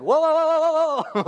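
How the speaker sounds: very high, loud string of whoas; hammed-up high-pitched alarm; frantic stopping call. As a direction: urgently halt someone about to act. Imagines himself frantically stopping jailer.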